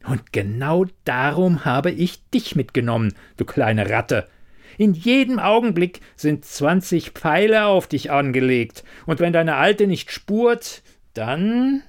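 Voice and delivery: menacing threat, rising in pitch to a hanging last word; hissed with evil grin. A narrator's reading. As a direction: threaten through a nasty grin. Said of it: "Mit bösem Grinsen zischte er".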